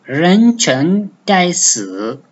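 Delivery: "Renceng daisu" slow, each syllable given its tone; slow Mandarin demo; Chinese tones. Shown as a demonstration. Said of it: "daisu the fourth tone".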